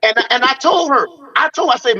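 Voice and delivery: usual speaking voice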